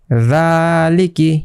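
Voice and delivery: রালিকি drawn out slowly, the long a held one beat; one alif stretch. Shown as a demonstration. Teacher showing the class how to read রালিকি slowly. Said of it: "এক আলিফ করে টান".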